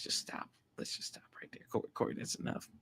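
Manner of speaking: whispered, telling himself to stop; a sharp whispered stop to yourself; self-hushing whisper. Real, not acted. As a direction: hush yourself under your breath. Hushing himself mid-rant.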